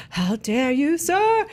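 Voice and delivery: pompous offended rise on sir; mock outrage. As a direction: play a scandalized gentleman. Mock outraged internet quote.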